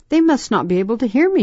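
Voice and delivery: put-on hurried, high child's inner voice; quick worried thought.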